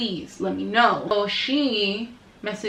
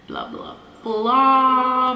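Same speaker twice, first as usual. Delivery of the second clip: drawn-out, higher mock rambling; dismissive blah-blah; 4 semitones higher, 3 dB louder, much slower than usual. Vlogger skimming the girlfriend's rant, dismissive drawn-out blah-blah. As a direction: drag the last blah out, dismissively. Trailing off a retold speech.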